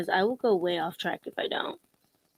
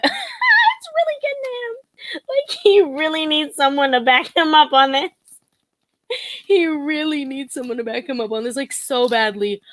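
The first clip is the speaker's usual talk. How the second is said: mocking him; 7 semitones higher, 7 dB louder than usual. Mocking Derek's anger to her viewers, high and pitying. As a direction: squeal it like you feel sorry for him. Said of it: "I'm like".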